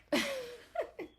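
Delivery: bright high burst of laughter. Real, not acted. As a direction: laugh at her own joke. Laughs after her joke.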